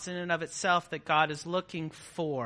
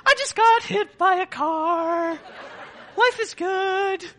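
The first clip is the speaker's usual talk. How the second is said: high, laughing fake-cheerful voice, slow on the last words; cheesy, forced-bright optimist with a laugh in it; 12 semitones higher, 5 dB louder than usual. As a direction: play a grinning always-happy believer shrugging off disaster. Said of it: "joy all the time Christians".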